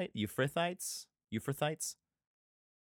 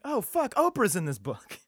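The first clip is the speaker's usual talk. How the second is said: high surprised outburst; hammed-up sudden shock; mock shock; 10 semitones higher, 3 dB louder than usual. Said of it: "my first reading of it would have been like".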